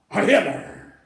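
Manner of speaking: loud, short salute shout; imitated military chant, loud. Troops saluting Hitler, retold.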